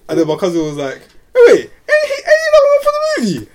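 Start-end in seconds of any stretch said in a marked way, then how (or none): 1.3-3.5 high excited shout; high excited cry of recognition. Retold or imitated. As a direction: squeal it like you just spotted a star. Cousin recognising the actor.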